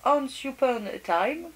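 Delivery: slow English title in a French accent; careful English words with a thick French accent. Real, not acted. Vlogger reading a paper collection's name in French-accented English. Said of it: "avec mon super accent anglais".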